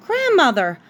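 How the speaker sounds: high, relieved outburst; relieved cry. Acted. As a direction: burst out with joy to see her safe.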